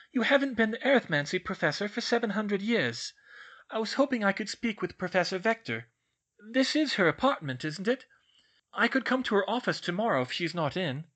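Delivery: pleading. A narrator's reading. Schoolgirl asks a portrait for her professor, pleading. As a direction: keep pleading in a lifted, earnest voice. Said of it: "Hermione pleaded".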